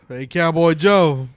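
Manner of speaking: slow, loud hail across a saloon; lightly acted western call-out; cowboy scene greeting. Friend hails the cowboy in an improv, playful. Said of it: "I'm a cowboy".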